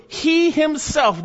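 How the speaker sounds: slow emphatic "He himself" with falling tune. Shown as a demonstration. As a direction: drive home "He" then "himself". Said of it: "It's emphatic in the original language".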